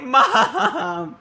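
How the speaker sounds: high laughing wail of Mom; half-laughing mock cry, high. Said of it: "And I just started crying".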